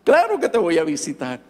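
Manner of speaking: a high, stretched "Pues claro" that settles back down; overjoyed, blurting out a promise; excited yes. Retold or imitated. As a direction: son thrilled to promise his father a visit. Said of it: "estaba tan emocionado que le dije".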